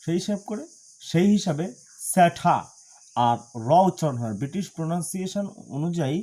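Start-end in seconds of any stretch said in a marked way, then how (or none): 2.1-2.6 "স্যাঠা" with a puffed T, no R; aspirated T. Shown as a demonstration. Teacher shows how T sounds, aspirated "স্যাঠা". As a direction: punch out "স্যাঠা" as SA-tha, hard T. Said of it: "টির উচ্চারণ ঠ হয়".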